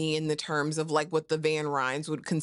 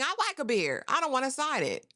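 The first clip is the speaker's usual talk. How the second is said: higher-pitched mock refusal; acted protest; 7 semitones higher than usual. Cook refuses the temperance pledge, mock protest.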